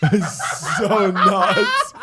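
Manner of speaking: stretched-out, loud high laughing cry; helpless high-pitched laughter; cracking up. Real, not acted. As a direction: laugh hard through drawn-out words. Cracking up over drool mishap.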